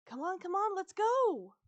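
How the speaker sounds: high, loud hurry-up call; rally them out with a rising shout; urgent urging. A narrator's reading. Girl-dog bounding to the door, urgent urging.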